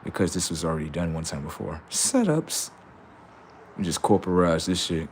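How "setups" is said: eerie setups. Acted. Eerie tag word.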